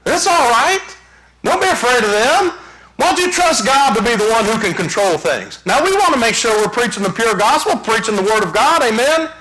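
high, ringing preacher's cry; fiery preaching. Acted. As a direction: preach it out, full force, to the congregation. Preaching at full force.

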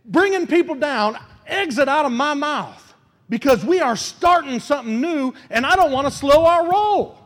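preacher giving the church a prayer to say; model prayer. Acted. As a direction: pray it out for them.

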